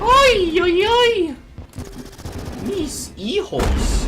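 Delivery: high, loud anguished wail; wailing mother. Acted. Sudden wail in Spanish.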